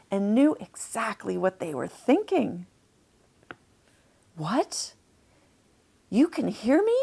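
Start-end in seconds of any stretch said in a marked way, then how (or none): 4.4-7.0 surprised hope. Acted. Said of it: "Chester thought hopefully".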